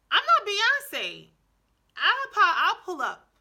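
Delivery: high, loud, slow playful warning shout; mock threat. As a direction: call out a hammed-up threat to trolls.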